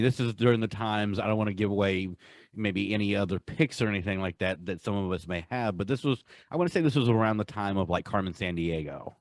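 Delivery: ordinary talk, nothing marked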